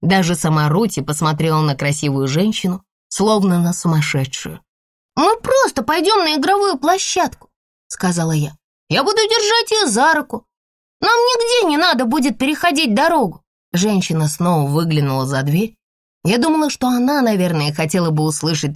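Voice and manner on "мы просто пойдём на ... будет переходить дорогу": put-on high eager little girl; earnest child promising; pleading child. A narrator's reading. (детская умоляющая интонация)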